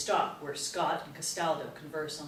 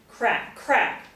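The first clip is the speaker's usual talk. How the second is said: two loud, higher punched words; gunshot cracks; 6 semitones higher, 11 dB louder than usual. A narrator's reading. Narrator sounds the shots hitting Rachel, sharply punched. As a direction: punch each word like a shot.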